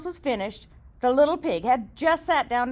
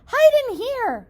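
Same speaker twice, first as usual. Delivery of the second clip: high, louder urgent call; 11 semitones higher, 6 dB louder than usual. Acted. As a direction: hurry them inside. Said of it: "cried the third pig".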